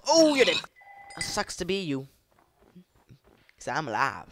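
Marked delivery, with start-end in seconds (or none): 0.1-2.1 high, loud crowing; loud high-pitched gloat; gleeful taunt. Real, not acted. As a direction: crow it at a beaten enemy. Taunting a doomed mob.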